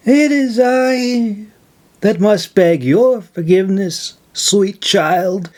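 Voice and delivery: humbly insist, in a crone's voice; old woman insisting. A narrator's reading. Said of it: "she insisted".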